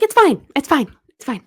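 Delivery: squeaky, high, quick brush-off; high-pitched it's fine. Retold or imitated. Daughter brushing off the awkward apology, high and tight. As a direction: wave it away in a tight high voice.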